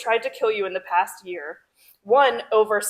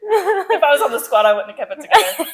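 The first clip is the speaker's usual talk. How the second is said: laughing; 6 semitones higher, 9 dB louder, faster than usual. Real, not acted.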